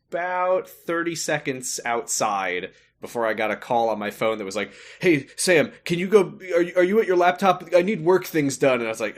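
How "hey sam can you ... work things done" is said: bossy caller. Retold or imitated. Boss call voiced in story.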